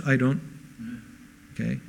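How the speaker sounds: ordinary talk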